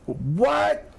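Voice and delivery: very high, incredulous squawked question; baffled Chief. Retold or imitated. Old boss still lost on surge protectors, utterly baffled. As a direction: imitate total bafflement, pitch leaping way up.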